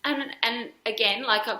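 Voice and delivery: usual speaking voice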